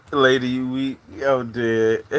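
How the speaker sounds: ordinary delivery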